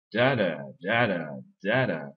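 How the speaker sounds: first "da" of each "da da" punched, second falling away; "da da" as stressed then unstressed syllable; strong-weak English rhythm. Shown as a demonstration. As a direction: beat out "DA da" in accented pairs. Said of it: "les syllabes qui sont pas accentuées".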